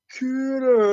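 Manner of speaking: long, high drawn-out holler; sergeant calling out. Retold or imitated. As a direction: imitate a sergeant hollering a name, stretched out. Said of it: "one of my sergeants, he's shaking me, he's going".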